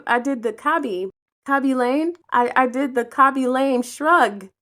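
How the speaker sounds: normal talking voice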